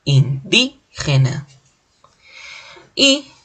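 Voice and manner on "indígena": "Indígena" slowly broken up, weight on "dí"; slow syllable demo of "Indígena", "dí" strongest; stress on antepenultimate syllable. Shown as a demonstration. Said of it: "fuerza de voz está en la antepenúltima sílaba".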